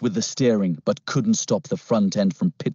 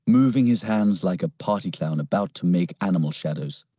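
ordinary delivery, nothing marked

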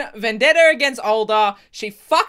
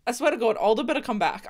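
ordinary talk

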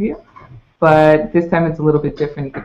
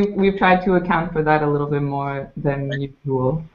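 normal talking voice

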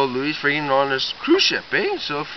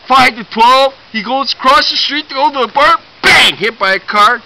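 louder and higher, racing to the crash; building to the punchline; 7 semitones higher, 10 dB louder than usual. Acted. Kid telling friends a joke, shouting the punchline. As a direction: belt out the ending as the big payoff.

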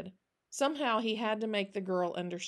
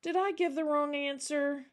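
put-on tearful child; 7 semitones higher than usual. Anne's eyes brimmed with tears.

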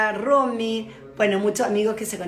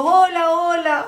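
mock cheerful friends' greeting; 6 semitones higher, 7 dB louder, slower than usual. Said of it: "y llega así tus amigos".

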